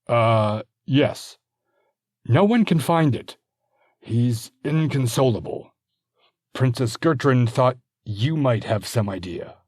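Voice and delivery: an awkward herald with a silly errand; sheepish messenger. Acted. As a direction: messenger admitting the errand is about a toy.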